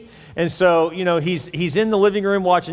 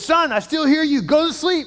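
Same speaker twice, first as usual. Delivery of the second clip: dad yelling; 11 semitones higher, 3 dB louder than usual. Acted. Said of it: "he says".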